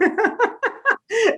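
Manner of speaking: a real high-pitched laugh; high burst of laughter. Real, not acted. Laughing at her own provocative chapter title, real laughter.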